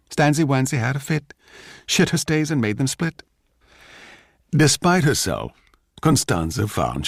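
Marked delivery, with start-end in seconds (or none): 0.1-3.2 wheedling. Acted. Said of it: "wheedle her out of her bad temper".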